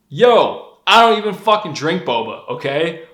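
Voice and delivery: exasperated retort. Retold or imitated. Answering girls who love boba, exasperated. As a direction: snap back, fed up. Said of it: "I'm like".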